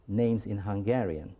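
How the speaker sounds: ordinary talk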